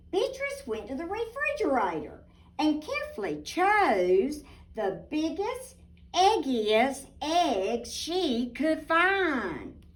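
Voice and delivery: put-on lively storybook narration for little girls; reading to children. A narrator's reading. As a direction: read it like a bedtime picture book. Picture book read aloud.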